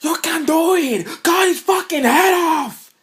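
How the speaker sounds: very high, loud screamed cheering; Rob Schneider yell. Retold or imitated. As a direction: yell it like a crazed fan at the back of a crowd. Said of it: "Rob Schneider in the background going".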